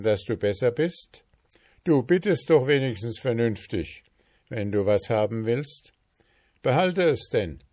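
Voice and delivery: gruffly pleased, sparing no one else; gruff dwarf king. Acted. Dwarf king to journeyman, high condescending approval.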